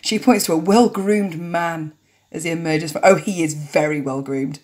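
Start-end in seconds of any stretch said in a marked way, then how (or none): none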